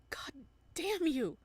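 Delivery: loud, high angry curse; angry shout. Acted. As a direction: spit the curse out. Voicing Adam angrily.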